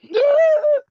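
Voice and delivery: sudden high laugh; a bright real laugh bursting out; burst of laughter. Real, not acted. Streamer bursting into laughter at herself.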